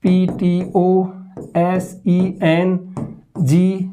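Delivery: letters drawn out one by one, very slowly; slow nonsense spelling. Shown as a demonstration. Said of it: "वो कुछ भी लिखते हैं".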